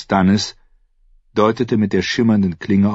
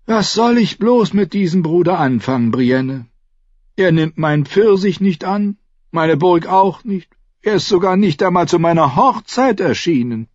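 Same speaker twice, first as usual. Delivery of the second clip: high, theatrical complaint about his brother; Renly, mock-exasperated; 12 semitones higher, 5 dB louder than usual. A narrator's reading. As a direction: lament him lightly, pitched high. (mit hoher Stimme gespielt verzweifelt klagen)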